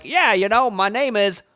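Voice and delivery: a playful high Boston-accent imitation of the character; Tom Hanks Boston accent. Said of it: "like, with an accent".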